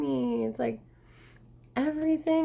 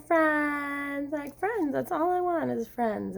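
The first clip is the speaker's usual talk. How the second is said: ordinary talk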